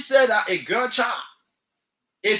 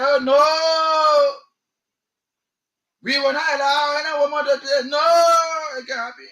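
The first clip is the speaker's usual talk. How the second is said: louder, higher mock shout of opponents; mock refusal shout; 3 semitones higher, 3 dB louder than usual. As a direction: bark it out like a stubborn objector. Opponents quoted refusing women.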